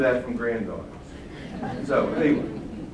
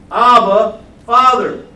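loud heartfelt cry; 6 semitones higher, 11 dB louder, slower than usual. Acted. Preacher voices the Spirit crying out, loud. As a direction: call it out loud to the Father.

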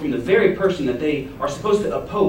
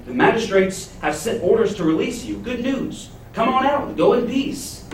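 cheery jailer; 8 semitones higher than usual. Acted.

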